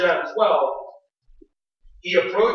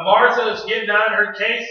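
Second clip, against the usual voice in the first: ordinary talk, nothing marked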